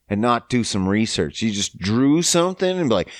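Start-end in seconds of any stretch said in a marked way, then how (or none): none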